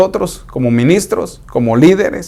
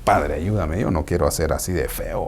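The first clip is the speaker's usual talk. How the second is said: soft, low, half-whispered prayer under the breath; a quiet aside to God; muttered prayer; 8 semitones lower, 11 dB quieter than usual. Retold or imitated. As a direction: murmur to yourself so nobody hears. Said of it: "digo yo".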